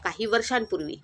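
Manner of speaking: usual speaking voice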